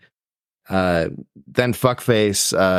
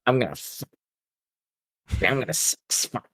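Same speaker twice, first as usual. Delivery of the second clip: mock stammering; 3 semitones higher, 6 dB quieter, faster than usual. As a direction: stutter it out, quiet and giggly. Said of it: "He's like".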